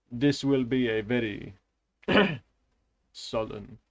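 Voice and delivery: lightly Russian-accented reading; attempted Russian accent. Shown as a demonstration. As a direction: try out a low Russian accent. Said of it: "in different accents with different cadences".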